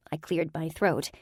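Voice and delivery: ordinary delivery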